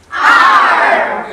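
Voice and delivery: shouted pirate R; pirate growl. Shown as a demonstration. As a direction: roar it like a pirate. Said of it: "punctuate everything with R".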